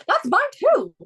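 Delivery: high, loud delighted squeal; excited. Real, not acted. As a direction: shoot way up in pitch, thrilled. Excited repeat on shared favourite rider.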